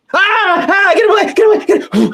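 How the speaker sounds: high shouted mock panic; hammed-up fright. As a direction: yell at a pretend ghost. Pretend fright at ghost.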